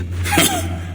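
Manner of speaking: breathy high scornful laugh; mocking laugh. Villainous abbot laughing at the king.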